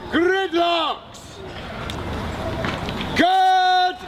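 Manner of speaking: ordinary delivery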